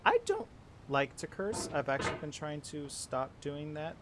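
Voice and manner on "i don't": far higher, loud yelp; shocked squeak. Real, not acted. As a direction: break into a startled squeal. Squeak of shock mid-fail.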